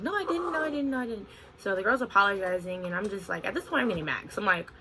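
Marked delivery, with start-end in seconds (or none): 0.0-1.3 high, whiny repeated denial; mock denial. Mocking the other manager denying it, high and whiny. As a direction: mimic the manager's denial, higher.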